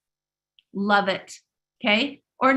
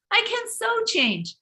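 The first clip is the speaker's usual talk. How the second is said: much higher, defiant insistence; mock triumphant retort; 10 semitones higher than usual. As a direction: fight back against the doubt. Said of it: "No, wait a minute".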